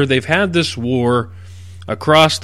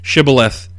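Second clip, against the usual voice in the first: normal talking voice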